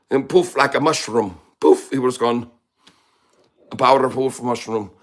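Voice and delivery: hammed-up Irish accent. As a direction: boast like an Irish leprechaun. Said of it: "We all love your accents".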